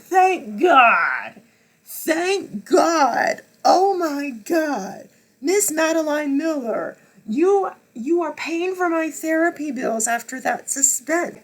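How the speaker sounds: high, loud, overjoyed cry; relieved joyful shout. Retold or imitated. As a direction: celebrate the ending. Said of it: "it's like".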